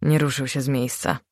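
plain reading voice

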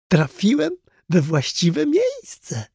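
high, drawn-out delighted squeak; slow, high-pitched thought of pure joy; joyful squeal. A narrator's reading. Clown reading the sign in the shop, joyful squeal. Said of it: "aż pisnął z radości".

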